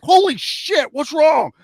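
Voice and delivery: high, loud startled yell; shocked outburst. Retold or imitated. Recalling his friend pulling off his leg, shocked yell. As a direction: blurt it out, stunned by the leg.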